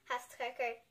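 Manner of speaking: ordinary delivery, nothing marked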